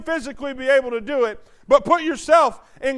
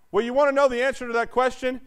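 ordinary talk

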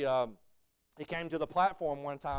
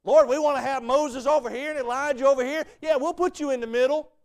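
eager disciple; 9 semitones higher, 10 dB louder than usual. Retold or imitated. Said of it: "And he's like".

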